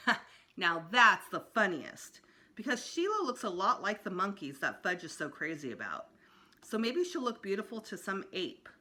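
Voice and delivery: usual speaking voice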